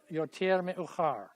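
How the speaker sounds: יותר מאוחר said fast as a model line; quick model line. Shown as a demonstration. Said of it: "everybody say".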